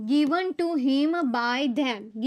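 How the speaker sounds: very slow, word by word; slow dictation. Shown as a demonstration. Teacher dictating passive answer to students, slow. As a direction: spell out each word slowly. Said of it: "અથવા તો by them to him".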